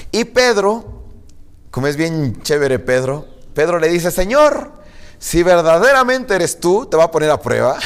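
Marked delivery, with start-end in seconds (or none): 4.1-6.8 higher-pitched, emphatic appeal; acted challenge. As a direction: play Peter putting his Lord to the test. Acts Peter testing Jesus. (reto de Pedro, agudo y enfático)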